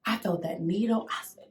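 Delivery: normal talking voice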